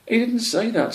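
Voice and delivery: high, fussy correcting voice; pedantic hedgehog. Acted. First hedgehog corrects the other, high and fussy. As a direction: correct him fussily.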